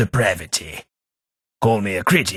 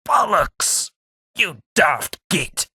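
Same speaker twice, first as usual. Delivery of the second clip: pained curse; 7 semitones higher than usual. Acted. Hit in combat, cursing.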